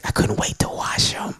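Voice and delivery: hushed confession, voice dropped to a breath; whisper. Real, not acted. Pastor confides to congregation about new knives, whispering. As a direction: whisper it like a guilty secret. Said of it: "whispered aside".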